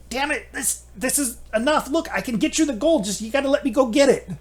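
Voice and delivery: high, fast panicked bargaining; desperate pleading. Acted. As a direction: blurt it out to be let go. Captive gives in, bargaining.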